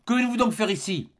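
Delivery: high-pitched exclaimed question; crying out. A narrator's reading. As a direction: cry out the question, voice lifted high. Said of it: "s'écria le capitaine". (question lancée en s'écriant)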